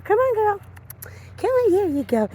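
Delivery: high sing-song coaxing to a dog. Real, not acted. Trainer coaxing her dog forward in high baby talk.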